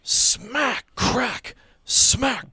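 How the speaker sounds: high, punchy spoken sound effects; sharp impact noises. Acted. Game master voicing four blows, sharp and high. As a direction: hit each one separately and loudly. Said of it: "hear and feel four".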